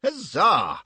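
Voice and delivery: high, loud triumphant cheer; loud, high victory shout; roaring cheer. Acted. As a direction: roar with delight. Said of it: "roars Stuart".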